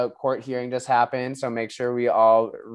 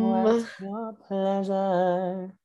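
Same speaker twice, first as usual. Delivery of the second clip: sultry tease; 7 semitones higher, much slower than usual. Pretend. Teasing slow seductive question.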